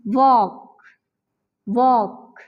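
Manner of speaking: वॉक with a silent L and stress on the whole word; वॉक said as one stressed syllable; correct pronunciation demo. Shown as a demonstration. Teacher models walk for students. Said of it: "इसे हम किस तरीके से pronounce करेंगे".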